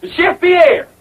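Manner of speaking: insistent call. Acted. Calls again when chef fails to appear.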